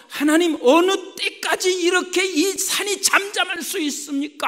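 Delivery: high, loud plea to God; crying out. Acted. Preacher voices Habakkuk pleading with God, crying out. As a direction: cry out in urgent complaint. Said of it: "지금 부르짖는 거예요".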